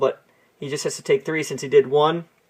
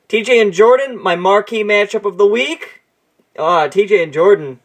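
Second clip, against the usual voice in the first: ordinary talk, nothing marked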